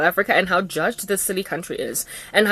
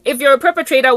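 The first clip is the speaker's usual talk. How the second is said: usual speaking voice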